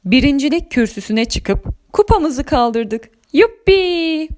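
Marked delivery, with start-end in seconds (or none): 3.3-4.3 tiz, coşkulu sevinç; neşeyle, tiz sesle kutla; sevinç nidası. Acted. Kupayı kaldırma sevinci. (neşeli zafer nidası)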